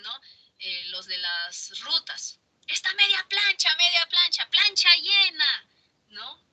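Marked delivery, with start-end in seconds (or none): 2.7-5.7 bus conductor call. Retold or imitated. Teacher imitating a bus conductor for her class, high calling voice. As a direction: call out like a Lima bus conductor touting seats. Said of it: "En los carros dicen".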